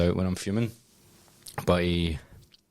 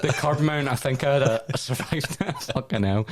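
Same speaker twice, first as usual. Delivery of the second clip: laughing; 7 semitones higher than usual. Real, not acted. Joking about the curb, laughing.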